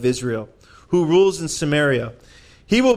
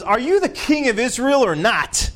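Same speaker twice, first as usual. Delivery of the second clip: higher, scornful queen mocking her husband; a proud, taunting queen, pitched up; Jezebel's voice; 8 semitones higher than usual. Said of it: "here's that voice of Jezebel again".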